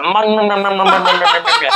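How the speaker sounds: playful mock munching with a laugh, rising at the end; greedy munching noises. Imagining partying in LA, hammed-up greedy munching. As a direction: smack the lips like digging in with a big spoon. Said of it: "I'll be getting that big fucking spoon out, and I'll be like".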